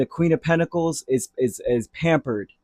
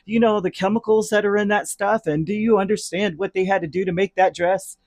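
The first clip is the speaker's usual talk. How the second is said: much higher, whiny worrier impression; mock a fussing worrier's voice; mock fretful whiner; 6 semitones higher than usual.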